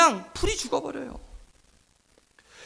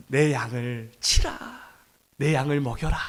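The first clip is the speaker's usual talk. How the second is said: entrust it quietly, with solemn tenderness; retold voice of Jesus, hushed and unhurried; gentle encouragement; 8 semitones lower, 5 dB quieter than usual. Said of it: "격려하셨어요".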